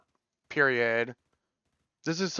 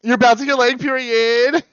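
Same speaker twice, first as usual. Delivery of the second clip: excited gush; 8 semitones higher, 5 dB louder, faster than usual. Real, not acted. Gushing about the song.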